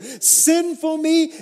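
can't believe he's chosen; disbelieving ordinary me; incredulous. Acted. Peter's astonished reply.

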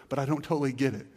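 ordinary talk, nothing marked

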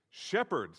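"shepherd" set apart, strong on the first syllable and falling; emphasis on shepherd. Shown as a demonstration. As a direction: hit "shepherd" hard as the key word. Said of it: "Let's emphasize a different word".

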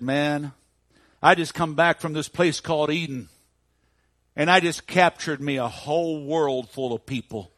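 gloating, boasting. Retold or imitated. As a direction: brag big, stretching out the last phrases. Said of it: "he was gloating, and he was boasting".

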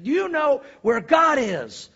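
loud, high, stretched-out demand; slow hammered question, acted fury; really upset pastor. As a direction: draw out every word at high pitch. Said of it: "got really upset. He's like".

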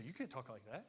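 lightly acted, hushed objection; confronting. Disciple objecting to his teacher's words, mildly confronting. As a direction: protest softly, as if correcting your teacher. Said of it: "He will confront Jesus".

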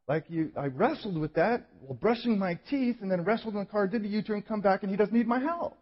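high-pitched, exasperated recap; confused complaint. Retold or imitated. Man wondering what the point was, in a high, baffled complaint.

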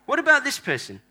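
normal talking voice

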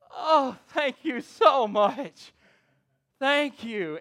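high, loud, drawn-out gratitude; effusive thanks. Retold or imitated. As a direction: imitate an overwhelmed, grateful mother. Acted grateful, relieved mother.

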